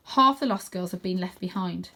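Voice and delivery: ordinary delivery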